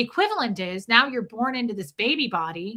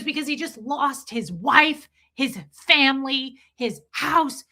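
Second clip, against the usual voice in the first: high mock rant building to a loud peak; retold screaming; 5 semitones higher, 4 dB louder, slower than usual. Lady listing what the baby lost, imitated screaming. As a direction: list it as her escalating yell, last item stretched. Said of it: "she starts screaming at me".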